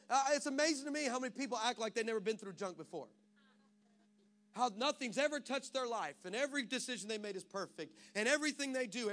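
usual speaking voice

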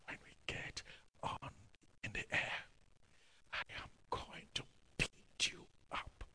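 hushed menacing whisper; whispered threat. Retold or imitated. Angry passenger threatens the pastor, whispered. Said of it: "He whispered it".

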